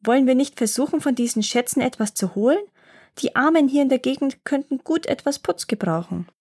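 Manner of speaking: lightly voiced boy bubbling over with a plan; boy pitching his treasure plan to the gnomes, eager and quick; eager little boy. A narrator's reading.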